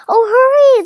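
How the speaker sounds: high anxious hurry; dragon pleading. Acted. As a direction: beg him to be quick. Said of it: "the dragon kept saying".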